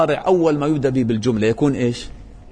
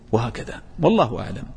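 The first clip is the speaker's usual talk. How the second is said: ordinary talk, nothing marked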